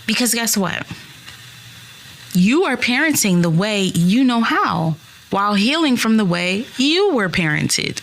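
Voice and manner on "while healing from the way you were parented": ordinary talk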